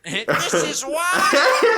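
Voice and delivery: high, slow laughing yell; laughing shout. Real, not acted. Guest howling at the double face joke, slow laughing shout. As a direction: stretch it out while howling with laughter.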